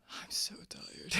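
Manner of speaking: soft, slow, drowsy whisper; tired whisper. Acted. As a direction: let it sag with sleepiness.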